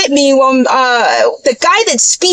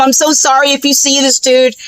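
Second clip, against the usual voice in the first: normal talking voice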